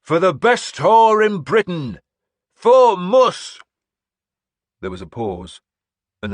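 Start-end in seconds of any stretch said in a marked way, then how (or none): 0.0-3.6 slow loud bellow, much higher, x0.7 slow; bellowed war cry. A narrator's reading. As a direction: roar it so the whole line hears. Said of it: "I bellowed".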